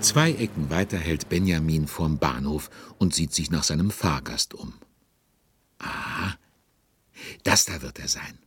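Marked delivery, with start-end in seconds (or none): none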